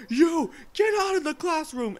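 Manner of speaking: high, loud imitation of a furious teacher; angry yell. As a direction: yell a student out of the room. Angry teacher throwing student out.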